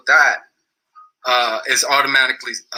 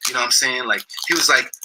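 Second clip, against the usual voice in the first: ordinary delivery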